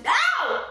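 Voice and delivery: sharp high yelp of pain. Real, not acted. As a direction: yelp when scratched. Clawed through the door.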